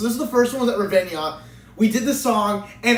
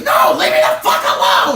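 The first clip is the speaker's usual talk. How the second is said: angry yell; 8 semitones higher, 8 dB louder than usual. Retold or imitated. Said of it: "He goes".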